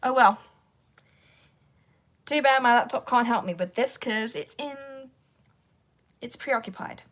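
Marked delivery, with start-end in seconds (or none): none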